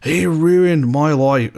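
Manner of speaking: put-on half-hearted Australian accent, slow; failed Australian accent. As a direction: attempt the accent and give up. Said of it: "I can't do one right now".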